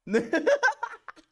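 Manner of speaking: loud high cackle; high burst of laughter. Real, not acted. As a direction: laugh out helplessly. Reacting to clip.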